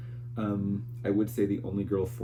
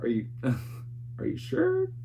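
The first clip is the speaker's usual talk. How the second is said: incredulous; 5 semitones higher than usual. Retold or imitated. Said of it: "and I was like".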